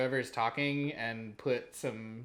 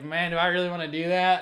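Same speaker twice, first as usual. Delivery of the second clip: ordinary delivery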